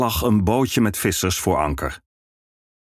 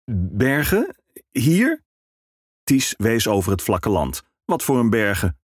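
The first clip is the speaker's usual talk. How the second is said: puzzled minstrel; 3 semitones higher than usual. Acted. Ties puzzled.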